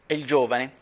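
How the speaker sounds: ordinary delivery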